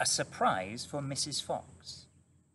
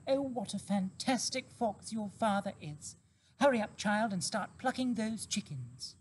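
revived mother fox; 9 semitones higher than usual. A narrator's reading.

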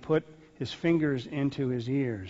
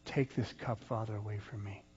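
hushed prayer; 3 semitones lower, 6 dB quieter than usual. Retold or imitated. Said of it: "who said what?".

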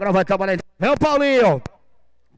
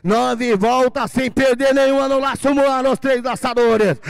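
drawn-out announcer call; 4 semitones higher, much slower than usual. Real, not acted.

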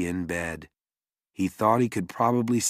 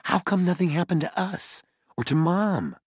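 younger brother; 10 semitones higher than usual. Acted. Little brother's voice.

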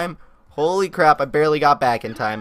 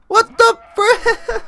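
much higher, slow drawn-out outrage; shocked disbelief; 7 dB louder, much slower than usual. Real, not acted. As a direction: stretch it out in stunned disbelief. Dinosaur just drowned.

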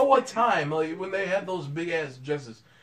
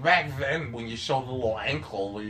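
ordinary talk

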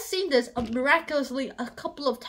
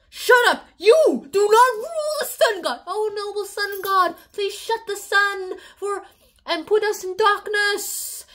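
higher, louder shouts; mock the prisoner, then plead; mock angry captors; 6 semitones higher, 4 dB louder than usual. Incas mocking Tintin, hammed-up high shouting. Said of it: "they're all like".

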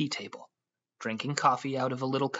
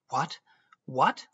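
surprised; 2 semitones higher, 4 dB louder than usual. A narrator's reading. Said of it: "she cried in surprise".

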